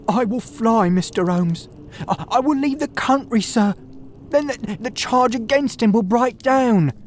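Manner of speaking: a cornered thief pleading with the detective; panicked Ryder pleading. A narrator's reading. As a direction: beg for your life, voice cracking up high.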